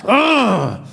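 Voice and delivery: high, strained mock roar; Incredible Hulk roar. Player losing at basketball, anger building, Hulk roar. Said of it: "Incredible Hulk is trying to come out".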